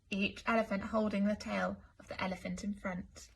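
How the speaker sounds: ordinary delivery, nothing marked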